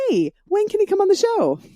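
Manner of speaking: high-pitched, bubbly ask; eager retold invitation. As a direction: pipe up high as if you can't wait. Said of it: "Gina a message saying".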